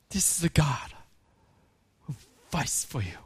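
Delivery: unvoiced, hushed close; whisper. Pastor closing the appeal to the church in a whisper. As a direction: drop to a whisper for the final line.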